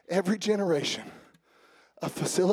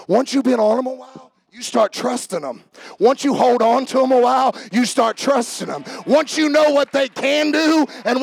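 preaching; 5 semitones higher, 10 dB louder than usual. Acted. Rising preaching.

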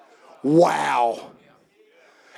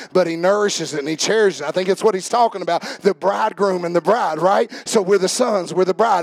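normal talking voice

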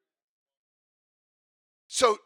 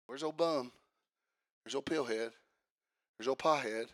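mock name-calling; 7 semitones lower, 9 dB quieter than usual. Voices people labelling others dismissively.

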